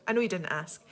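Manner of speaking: normal talking voice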